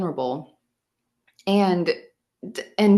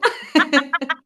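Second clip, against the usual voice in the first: tearful laugh; 10 semitones higher, 5 dB louder than usual. Real, not acted. Laugh with crying.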